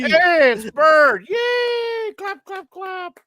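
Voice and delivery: high excited shout, slow. Real, not acted. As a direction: cheer like a fan greeting. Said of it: "excited greeting".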